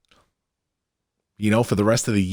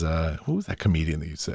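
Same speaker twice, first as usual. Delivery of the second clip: ordinary talk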